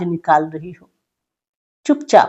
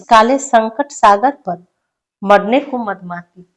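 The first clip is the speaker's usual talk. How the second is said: louder declaimed verse line; poem recitation; 5 dB louder than usual. Acted. Teacher reciting the line about iron chains, louder.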